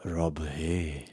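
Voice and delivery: put-on low, soft Scottish accent. Host introducing the Scottish listener, mock Scottish accent. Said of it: "Is that a, a Scottish accent?".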